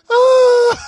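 very high, loud "oh"; whooping laugh cry. Real, not acted. Son hears the concussed-player joke, loud laughing whoop. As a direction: whoop while cracking up.

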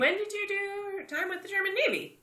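high, incredulous question; surprised. Real, not acted. Questioning friend about unknown navy service, surprised. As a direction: pitch shoots up in astonishment.

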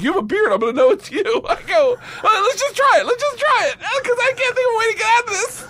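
high-pitched laughing talk; can barely get it out for laughing. Real, not acted. Laughing through retold exchange.

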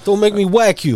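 hammed-up Goodfellas gangster warning; mock mobster threat. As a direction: friend jokingly doing a movie mobster who threatens to whack you. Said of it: "that Goodfellas character to come out".

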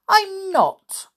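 sharp, high, loud protest; indignant outburst, pitched high. Acted. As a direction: snap back, offended little sister. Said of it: "said Anne indignantly".

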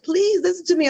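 high, pleading plea to a stranger. Retold or imitated. A woman on an elevator begging a rude reporter to listen, pleading. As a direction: beg her to hear you out.

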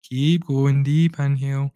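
guided breathwork. Acted. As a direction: lead the listener gently through each breath. Leads a guided breathing session.

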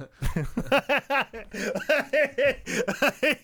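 high-pitched belly laugh. Real, not acted. Storyteller cracking up at his own punchline, laughing. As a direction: crack up helplessly.

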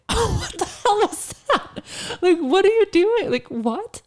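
high, laughing outrage, slow; laughing disbelief. Retold or imitated. Retelling her reaction to the rude man, laughing incredulous. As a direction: laugh through the shocked reply. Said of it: "And I was like".

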